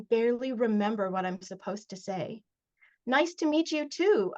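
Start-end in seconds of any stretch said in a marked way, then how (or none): 3.1-4.3 high-pitched, awkward "nice to meet you too"; a flustered, polite reply; finally managed. A narrator's reading. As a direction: get it out with effort, pitched up. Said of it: "I finally manage".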